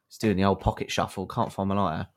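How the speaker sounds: normal talking voice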